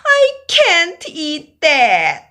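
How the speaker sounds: high, loud wailing complaint; crying child. Acted. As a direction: whine it through tears. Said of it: "I cried and cried".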